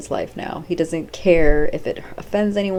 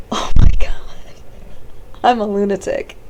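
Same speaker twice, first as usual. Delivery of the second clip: loud outburst straight out of a laugh; real laughter spilling into self-mockery; laughing in disbelief; 5 semitones higher, 10 dB louder than usual. Real, not acted. Podcaster amazed at her own rambling, laughing.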